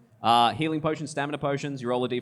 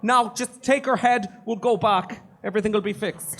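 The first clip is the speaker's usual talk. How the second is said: high, loud, chipper praise; a pleased employer congratulating; cheery Gunther; 13 semitones higher, 5 dB louder than usual. Acted.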